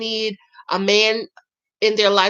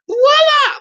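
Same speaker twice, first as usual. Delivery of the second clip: high, loud showy exclamation; ta-da flourish, pitched way up; triumphant flourish; 7 dB louder than usual. Real, not acted. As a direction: sing it out high and loud like a magic reveal. Flourish after cleanup steps.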